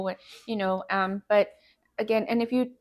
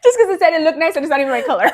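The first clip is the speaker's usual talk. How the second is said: fast, loud, high and laughing; laughing mock protest; 6 semitones higher, 9 dB louder, much faster than usual. Wife hamming up her own fussiness, laughing. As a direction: rattle it off through laughter.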